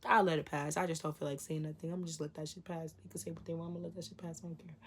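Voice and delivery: very soft, trailing away; low-volume, acted indifference; resigned self-talk. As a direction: shrug it off under your breath. Acting out letting things slide.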